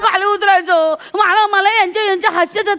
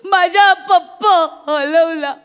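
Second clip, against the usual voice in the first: put-on slow, lower, wailing child; childish wail; 4 semitones lower, much slower than usual. A lost girl wails to the host, slow childish whine. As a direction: drag it out like a sulking lost kid.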